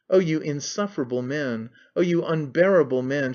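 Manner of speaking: usual speaking voice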